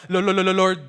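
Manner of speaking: loud stammer on Lord; mock stutter. Preacher mimicking a nervous stuttering prayer, loud and halting. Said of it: "nagsastutter ka".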